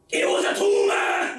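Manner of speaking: high, loud mock yell; a mom blurting the cause of death; blurted shout. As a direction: shout the news out of nowhere. Mom's invented blurt, then denied.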